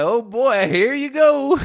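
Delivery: very high, loud whoop on the edge of laughter; hammed-up reaction to a film's twist; gleeful mock alarm. Mock reaction to horror film twist.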